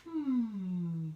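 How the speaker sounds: high, loud thinking hum; little old lady pondering. Acted. Old lady humming bored to her husband.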